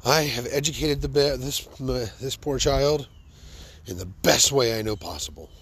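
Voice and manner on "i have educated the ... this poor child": normal talking voice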